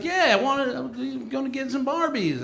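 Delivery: high-pitched hillbilly voice; cartoon hillbilly. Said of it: "cartoon hillbilly".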